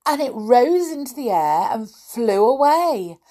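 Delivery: put-on children's story reading. A narrator's reading. Reader tells children of runaway roundabout animals, animated storytelling. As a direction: build the wonder for young listeners.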